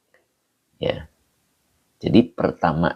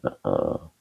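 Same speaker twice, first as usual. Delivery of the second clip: usual speaking voice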